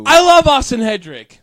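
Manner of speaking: high, loud declaration of love; loud gushing. Pretend. Co-host answering a fan's love note, loud gushing. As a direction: burst out adoringly.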